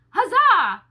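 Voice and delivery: cheer. Acted. Narrator cheers at Jefferson's name.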